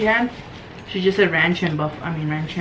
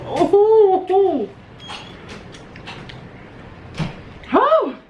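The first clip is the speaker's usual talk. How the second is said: spicy heat gasps; 11 semitones higher, much slower than usual. Real, not acted. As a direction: react to the burn of a hot wing. Reacting to spicy wing.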